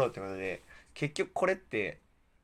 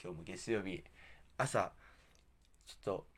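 slurred speech around a mouthguard; talking with a mouthguard in; wearing a mouthguard; 2 semitones lower, 6 dB quieter than usual. Real, not acted. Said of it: "マウスピースをつけながら滑舌の悪い中".

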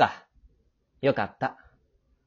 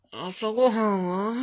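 sleepy question; 6 semitones higher, slower than usual. Acted. Sleepy boy waking up.

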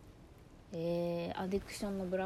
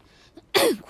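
loud rough throat clear; 11 semitones higher, 16 dB louder than usual. Real, not acted. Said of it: "声ガラガラだな。".

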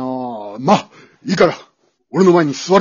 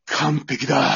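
normal talking voice

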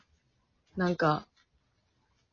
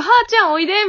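higher, louder friendly call of a karate senior; senpai calling; 9 semitones higher, 5 dB louder, faster than usual. Retold or imitated. Karate senior inviting her to practice, bright friendly call. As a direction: beckon her over brightly, as the senior did. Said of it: "みたいに言われたら".

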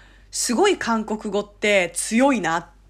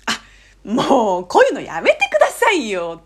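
emphatic protest; 5 dB louder than usual. Retold or imitated. Korean given a thank-you gift, insistently protesting. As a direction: protest warmly, stretching the ending. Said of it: "これも念押しだね".

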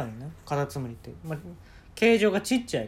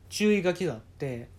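ordinary talk, nothing marked

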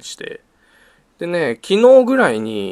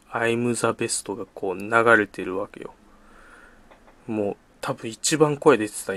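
ordinary talk, nothing marked

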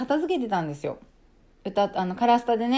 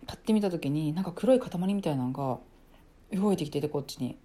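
ordinary talk, nothing marked

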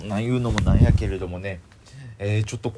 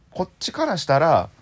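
normal talking voice

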